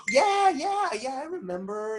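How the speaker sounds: very high-pitched yeahs, easing down; squeaky mock agreement, settling at the end; retold eager agreement. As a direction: imitate a high, drawn-out yeah-yeah. Retells own sheepish reply to her question.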